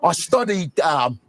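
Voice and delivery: put-on British accent, measured interview voice; Idris Elba. British actor telling an interviewer about his role, British accent. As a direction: imitate a British actor discussing his research. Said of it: "And then I'm watching B B C".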